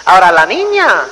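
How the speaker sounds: ordinary delivery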